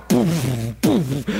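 low mock pounding noise; imitated throbbing boom. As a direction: puff out two deep booms. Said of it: "she's sitting there going".

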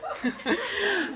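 short squeal of laughter; a high breathless giggle; high squeaky giggle. Real, not acted. Narrator laughing at her family, high giggle. Cracks up again.

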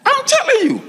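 very high, exclaiming insistence; high emphatic cry. Real, not acted. Preacher insists to congregation the story is true, high emphatic cry. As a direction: cry it out at the top of the voice.

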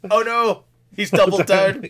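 high, loud hammed-up alarm; mock alarm. Listener reacting to the author doubling down, high loud mock alarm. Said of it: "He goes".

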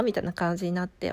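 ordinary talk

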